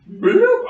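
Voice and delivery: high popping bloop sound; cartoon bloop. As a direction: pop it out like a cartoon. Sound effect while moving.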